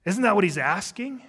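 a hint of a shocked child; startled kids. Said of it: "A couple kids just looked at mom like".

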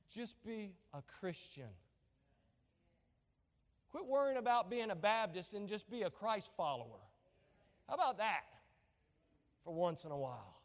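usual speaking voice

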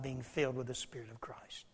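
hushed, breathy whisper. Real, not acted. Preacher rebuking the church, whispered. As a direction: drop it to a whisper for weight. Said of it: "preacher drops to whisper".

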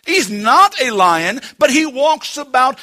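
emphatic, driving sermon voice; full-force preaching. Acted. Preacher warning church about Satan's attacks, forceful. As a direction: hammer the warning home from the pulpit.

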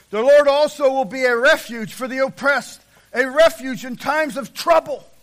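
scripture proclamation. Real, not acted. Preacher reading the Psalm to the congregation, loud proclamation. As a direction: proclaim the verse at full force.

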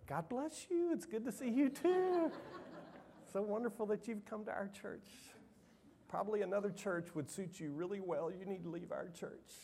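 high, syrupy mock-friendly greeting with a laugh; sugar-coat the dismissal, all fake smile; sarcastic sweet brush-off. Pastor imagining a saccharine reply to the rude man. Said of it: "No, I didn't say any of that".

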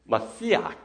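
"Masiach" with a throaty final ch; Hebrew pronunciation. Shown as a demonstration. Pastor gives the Hebrew word, "Masiach" with guttural ending. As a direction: end "Masiach" on a catch in the throat. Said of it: "this little catch in their throat".

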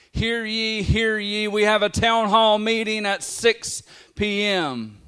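loud, high public announcement; town crier. Retold or imitated. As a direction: ring out the news to the whole town. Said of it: "town carriers would walk ... would call out".

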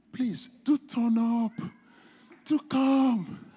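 high, drawn-out plea; stretched, coaxing request; pleading appeal. Real, not acted. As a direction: urge them to come, slow and high. Pleading with congregation to attend.

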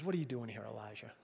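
low, very soft, intimate question; hushed voice fading to a whisper; gentle whisper. Retold or imitated. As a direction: ask it under the breath, for one listener only.

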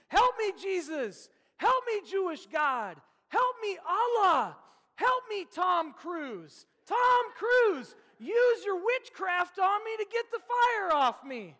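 high, loud, slow panicked shouting; hammed-up screaming to everyone for help; frantic yelled pleas. Preacher acts out Ricky Bobby begging everyone, screaming in panic. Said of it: "the litany of people he wants to call out to".

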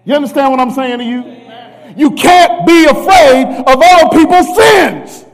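shouted preaching. Real, not acted. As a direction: preach it to the rafters.

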